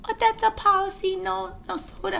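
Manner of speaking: high-pitched mock Chinese accent; broken-English accent. Restaurant lady stating the soda policy, hammed-up Chinese accent. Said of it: "this is in a broken, uh, English".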